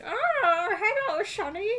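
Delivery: put-on high, loud creaky old-timer greeting; old man voice. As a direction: play a doddering old man saying hello. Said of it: "like, an old man voice".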